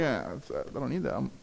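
a quiet, easy no thanks; hushed, satisfied shrug in the voice; mock content refusal. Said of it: "You're like".

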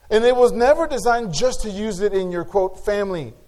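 a pastor urging the church to use their gifts, preaching hard; full-force preaching. Acted. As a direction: preach it out to the congregation.

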